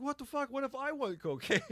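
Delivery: high, outraged mock protest; indignant buddy. Buddy offended by the Viagra offer, high mock outrage. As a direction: act insulted he got offered Viagra.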